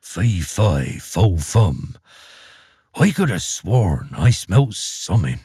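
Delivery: low grumbling ogre mutter. Acted. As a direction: grumble it under your breath like an ogre. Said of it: "he would mutter to himself".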